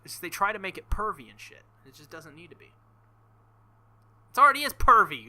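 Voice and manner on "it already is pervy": exasperated yell. Real, not acted. Ranting outburst at the game.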